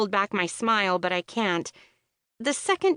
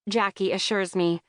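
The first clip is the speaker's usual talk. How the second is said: plain reading voice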